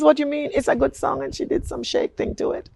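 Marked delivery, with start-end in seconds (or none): 0.0-1.2 sister-in-law quoted. Retold or imitated. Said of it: "And she says".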